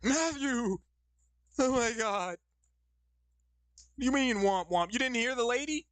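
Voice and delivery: high, loud, dismayed outburst; exasperated disbelief. Real, not acted. Streamer to viewer who missed the audio, high disbelief. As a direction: cry out in mock-dramatic disbelief.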